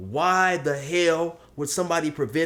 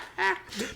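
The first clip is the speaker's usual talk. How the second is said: high mock sobbing; hammed-up sob; 8 dB quieter than usual. [name] finishing his breakdown, high mock sobs. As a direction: trail away in squeaky fake sobs. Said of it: "[name] is crying and stuff".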